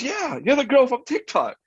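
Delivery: high-pitched mimicked fan voice; excited recognition. Stranger confirming she knows his girlfriend, high and excited.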